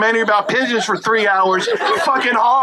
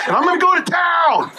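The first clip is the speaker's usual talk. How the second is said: excited shout; 9 semitones higher than usual. Acted. Husband on the phone to his wife, shouting in high excitement. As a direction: crow it like a man who can't wait.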